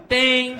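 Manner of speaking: high shouted bell ding. Retold or imitated. Comic imitates the boxing bell, loud high ding. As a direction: ring it out like a fight bell. Bell sound after knockout.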